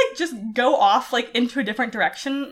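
ordinary delivery

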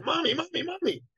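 put-on very high little-boy voice; excited little boy. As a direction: imitate a small boy eagerly pestering his mom. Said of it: "that excited way little boys do".

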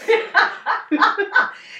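cracking up loudly; big high laugh. Real, not acted. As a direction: burst of squealing laughter. Laughs after reading quote.

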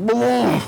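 high, loud-ish mock vomit noise; imitated retching. Storyteller shows himself vomiting into a cup holder, imitated retching. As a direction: imitate throwing up, hammed-up. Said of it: "I, like, vomit into it".

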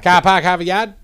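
Boston accent, dropped R's. Shown as a demonstration. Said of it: "break down the New England accent".

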